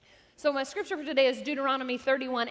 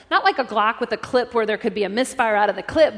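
ordinary delivery

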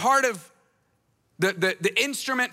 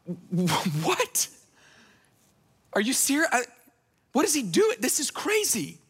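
stunned halting start, then pitched up; mock disbelief; 2 semitones higher than usual. Followers react to a man walking on water, mock disbelief. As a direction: play a lightly hammed-up baffled reaction.

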